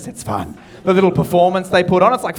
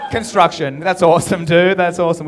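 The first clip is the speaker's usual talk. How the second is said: ordinary talk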